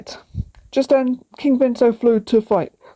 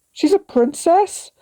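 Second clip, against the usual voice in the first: surprised; 8 semitones higher, slower than usual. A narrator's reading. Piku surprised, high voice.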